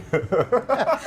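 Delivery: a high burst of laughter; laughing. Real, not acted. [name] recalling Rodney's elevator line, bursting out laughing. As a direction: break into a real laugh. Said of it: "we both laughed out loud".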